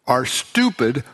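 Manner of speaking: ordinary delivery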